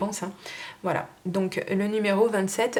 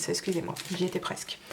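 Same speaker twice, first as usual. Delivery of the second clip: chuchoté; 6 dB quieter than usual. Real, not acted. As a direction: excuse chuchotée en aparté. Se reprend à voix basse. (excuse chuchotée, en aparté)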